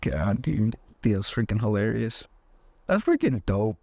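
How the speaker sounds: normal talking voice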